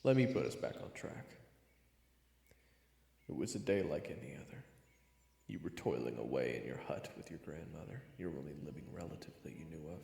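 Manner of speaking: plain reading voice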